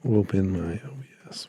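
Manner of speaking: whispered mutter to himself; whispering. Real, not acted. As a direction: whisper it under your breath. Muttering to himself while opening software.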